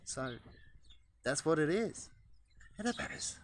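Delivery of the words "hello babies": whispered high hello; soft talk. Shown as a demonstration. Showing the soft voice the pigeons prefer, whispered. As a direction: breathe it out gently to the birds. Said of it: "soft noise, they like better".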